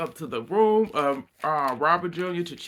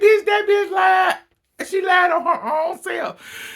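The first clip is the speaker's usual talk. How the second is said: screaming insult; 8 dB louder, slower than usual. Retold or imitated. Shouting retort at castmate in recap.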